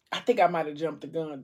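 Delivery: ordinary talk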